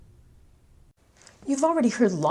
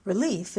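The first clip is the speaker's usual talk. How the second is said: "Relief" with the stress on "lief"; stress on second syllable. Shown as a demonstration. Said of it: "I stressed both on the second syllable".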